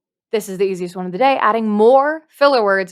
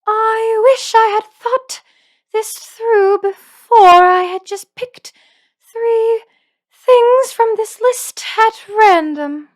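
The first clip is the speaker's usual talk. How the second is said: much higher, slow and louder, with every T hit hard; wild combined voice; 12 semitones higher, 6 dB louder, slower than usual. Shown as a demonstration. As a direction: crank the pitch up and punch the T's. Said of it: "up your pitch and your breathiness and hit all the T's really hard".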